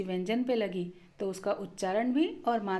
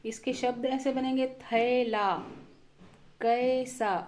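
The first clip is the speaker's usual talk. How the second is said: normal talking voice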